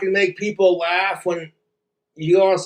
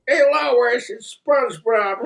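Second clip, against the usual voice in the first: high cartoon dad, long goofy laugh; raised, silly cartoon dad voice; Peter Griffin; 6 semitones higher than usual. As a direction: announce it as an oafish cartoon dad, then cackle. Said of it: "do a Peter Griffin voice".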